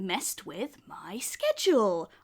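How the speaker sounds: high, purring darling voice; sweet menace, slowed right down; Frankie's voice. Acted. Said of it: "just be like".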